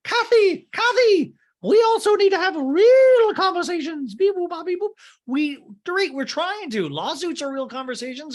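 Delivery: very high, loud squeak; hammed-up Dorit, squeaky and loud; mock Dorit. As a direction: shriek it in a high-pitched squeal. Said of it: "And Dorit goes".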